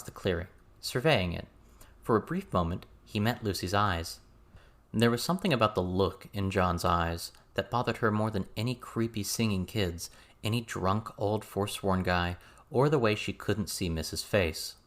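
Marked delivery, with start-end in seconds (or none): none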